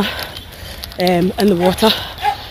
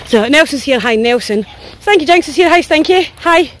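ordinary delivery

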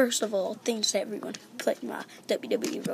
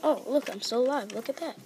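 ordinary talk, nothing marked

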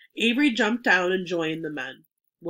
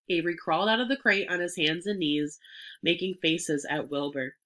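ordinary delivery, nothing marked

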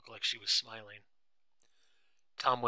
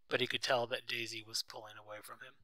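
usual speaking voice

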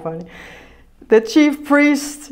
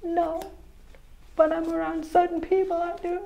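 mock soft voice; 5 semitones higher, 11 dB quieter than usual. Mocking a woman's reply.

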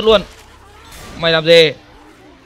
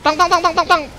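excited calling; 10 semitones higher than usual. Real, not acted. Calling out during game fight.

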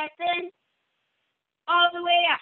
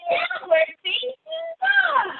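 high, cheering call of the pose; excited call; 10 semitones higher than usual. Real, not acted. Teacher calls the next pose to the children, excitedly. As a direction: announce the pose like a big surprise.

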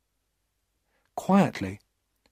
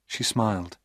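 plain reading voice

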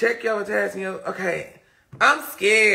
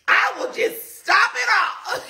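laughing mock despair; 13 semitones higher than usual. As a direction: ham up the despair through laughter. Mock despair about thumb.

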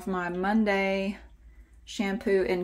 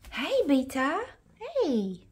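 high pet greeting; 6 semitones higher, slower than usual. Real, not acted. Greeting the cat, high pet talk.

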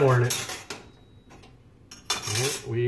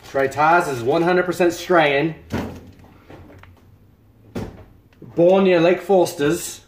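put-on higher, playful Australian accent; 5 semitones higher, 4 dB louder, slower than usual. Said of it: "Tars loves my Australian accent".

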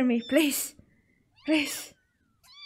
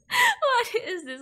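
laughing; 7 semitones higher than usual. Real, not acted. Repeated laughing.

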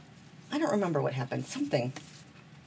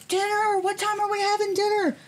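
high, loud mock yell calling for dinner; hollering for dinner like a hungry husband; 4 dB louder than usual. Husband yelling from another room about dinner, mock yell. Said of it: "he screamed and yelled".

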